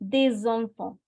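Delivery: des enfants with the S linked to the vowel; liaison in des enfants. Shown as a demonstration. Teacher shows students the liaison, des enfants linked. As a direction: join des onto enfants as "dez". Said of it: "making a liaison between S and E".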